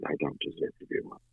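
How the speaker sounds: soft, almost voiceless line; hushed confession. Retold or imitated. Retelling Alex's line, hushed and soft. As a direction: hush it right down. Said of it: "his was so special".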